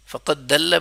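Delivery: فقط دل with ضاد turned into a plain dal; teacher showing a mistake; wrong dal sound. Shown as a demonstration. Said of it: "بڑی غلطی".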